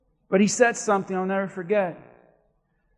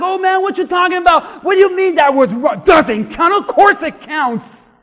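higher, louder shouting complaint; mock angry protest; 10 semitones higher, 11 dB louder than usual. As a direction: play up the outrage at the old man. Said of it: "I got mad and I was like".

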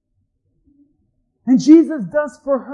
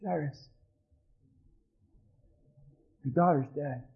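very low, hushed, heavy announcement; subdued, much lower and quieter voice; grave bad news; 8 semitones lower, 10 dB quieter than usual. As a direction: break terrible news softly, with long pauses. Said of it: "his friend comes behind him and said".

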